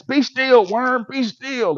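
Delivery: loud, high order to a squirming worm; mock frantic yell. Retold struggle baiting a hook.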